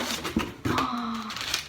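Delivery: long, soft gasp of wonder; touched gasp. Real, not acted. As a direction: draw a breath in, delighted. Sees how gift is wrapped.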